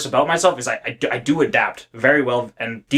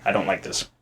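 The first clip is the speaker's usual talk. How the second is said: ordinary talk, nothing marked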